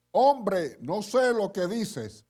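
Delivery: loud, slow, exasperated denial; spoke loudly. Retold or imitated. Pedro cursing at the bystanders, loud and slow. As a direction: shout him down. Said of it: "habló fuerte".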